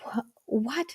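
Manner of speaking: quiet, rising double take; baffled. Acted. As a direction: stammer it softly in bewilderment. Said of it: "shake your head at and go".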